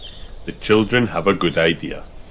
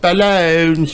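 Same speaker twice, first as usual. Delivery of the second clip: high cheerful seller shout; bright little hawker shout; Pedro calling; 9 semitones higher, 5 dB louder than usual. A narrator's reading. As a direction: call out your wares like a pony. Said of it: "shouts Pedro Pony".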